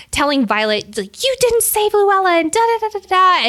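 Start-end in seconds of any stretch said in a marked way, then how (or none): 1.2-3.5 high, shrill-pitched mocking accusation; mocking high voice of an unhinged girl; unhinged Kat. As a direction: play her as the crazy one. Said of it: "Kat being crazy as fuck".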